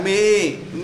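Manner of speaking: ordinary talk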